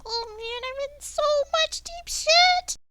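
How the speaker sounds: very high, tiny panicked imp; teeny imp voice. Acted. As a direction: squeak it out like a scared little imp. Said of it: "this little teeny voice".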